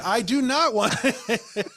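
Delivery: slow, high, laughing firm no; laughing refusal. Retold or imitated. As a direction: drag out the refusal through a laugh. Refusing add-ons, laughing.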